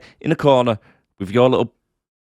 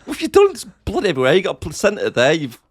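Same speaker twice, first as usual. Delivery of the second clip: horrified outburst; 5 semitones higher than usual. Retold or imitated. Phil reacts in horror to the bloody mess, high and shocked. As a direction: recoil at the mess, pitched right up.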